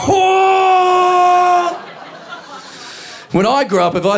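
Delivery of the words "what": high, loud shrieked "What?"; aghast yelp at a kid's cheek; shocked disbelief. Retold or imitated. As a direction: scream it in stunned disbelief. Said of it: "I was like".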